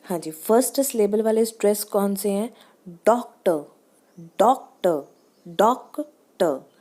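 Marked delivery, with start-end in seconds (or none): 2.9-6.7 doctor with डॉक punched, टर dropping away; first-syllable stress. Shown as a demonstration. Teacher showing first-syllable stress, DOC-tor for doctor. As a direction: split doctor, weight on डॉक. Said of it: "first syllable पे आ गया".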